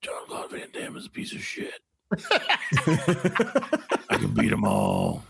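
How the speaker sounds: low whispered tough-guy voice; Steven Seagal impression. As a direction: whisper menacingly with squinted eyes. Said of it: "a Steven Seagal accent".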